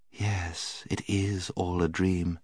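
soft, hushed assurance; lulling witch. A narrator's reading. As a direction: soothe her into the spell quietly. Witch lulls Jill into agreement.

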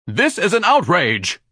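high, loud, slow outraged shout. Acted. Ignatius shouting at Dorian's guests, pompous outrage. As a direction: bellow it with pompous indignation. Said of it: "Ignatius was shouting".

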